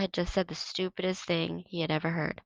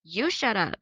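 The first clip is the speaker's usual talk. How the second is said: high angry snap; snapping; 6 semitones higher, 3 dB louder, slower than usual. A narrator's reading. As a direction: bite back at him. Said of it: "Tino snapped at him".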